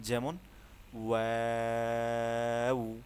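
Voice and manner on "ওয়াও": ওয়াও drawn out with rounded lips, louder; waw demo. Shown as a demonstration. Teacher models rounded-lip ওয়াও, louder and long. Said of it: "ঠোঁট automatic গোল হয়ে যাবে".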